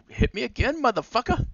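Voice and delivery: high-pitched, cheeky fly voice; taunting fly. Pretend. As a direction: squeak out a defiant little dare. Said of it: "if I hear a fly say that".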